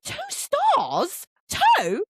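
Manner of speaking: very high outraged disbelief; incredulous. Retold or imitated. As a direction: throw it back, pitch shooting right up. Retold disbelief at cousin's rating.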